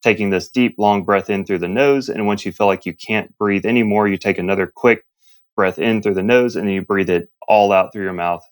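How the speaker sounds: ordinary talk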